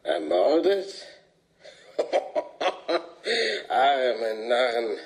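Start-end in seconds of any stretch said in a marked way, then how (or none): 2.0-3.6 a high, scornful cackle; eerie, mocking cackle; sinister laugh. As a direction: laugh at their foolishness. Laughs at the word murdered.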